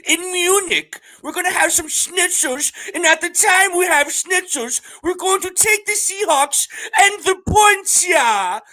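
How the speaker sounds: very high, loud, hammed-up German; mock German accent. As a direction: proclaim it slowly as a comic German caricature. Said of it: "What's a German accent?".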